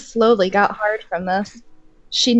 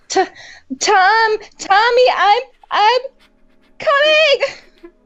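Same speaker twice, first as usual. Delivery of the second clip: much higher, louder falsetto moan building to a squeal; 11 semitones higher, 4 dB louder, slower than usual.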